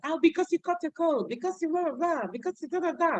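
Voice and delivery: put-on high childish answer; kids' voice. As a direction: answer like a small kid.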